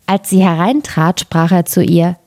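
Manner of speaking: usual speaking voice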